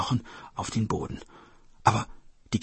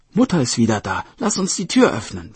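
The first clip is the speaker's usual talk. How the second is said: put-on louder, rising child's voice; eager little sister; 7 dB louder than usual. Sister thinks Mother is home, eager excited child. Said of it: "sagte die Schwester".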